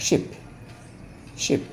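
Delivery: শিপ clipped with a short i like ship; short i demo. Shown as a demonstration. Teacher to students, showing শিপ with a short i. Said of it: "এটা লম্বা হবে না".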